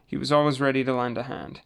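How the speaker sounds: ordinary delivery, nothing marked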